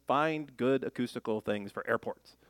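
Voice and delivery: ordinary talk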